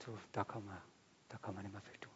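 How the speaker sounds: very soft, hopeless doctor's verdict; resigned murmur. Retold or imitated. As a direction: drop almost to nothing, no hope left. Doctor shakes head, hopeless verdict. (leise, resigniert gemurmelt)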